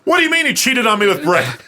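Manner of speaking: high, indignant mock quarrel; mock outrage. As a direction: act out a jealous partner on the phone. Acts out the phone argument.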